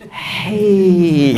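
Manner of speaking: "Hei" as a long exhaled hii; ha with kasrah. Shown as a demonstration. Teacher showing breathing practice, long exhaled "Hei". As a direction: breathe out slowly through a drawn "Hei". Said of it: "kasrah juga".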